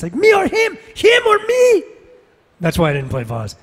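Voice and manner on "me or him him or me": wild high-pitched villain shout; Vaz. Acted. Actor mimicking a villain banging his chest, high frantic shout. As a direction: scream it way up high, unhinged. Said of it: "What does he say when he bangs his chest?".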